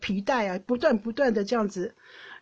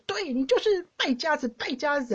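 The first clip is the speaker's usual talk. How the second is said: mother scolding; 6 semitones higher than usual. Retold or imitated. Mom scolding brother during beating.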